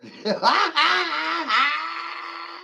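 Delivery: high howling laugh with a shout in it; cackling shout-laugh. Real, not acted. Mocking a critic, a wild howling laugh. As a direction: laugh like you've just won.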